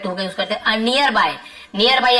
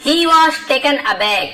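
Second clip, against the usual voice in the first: slow dictation; 4 semitones higher, much slower than usual. Shown as a demonstration. Teacher reading the lesson sentence to students, slow and deliberate. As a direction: read it out slowly so the class can follow.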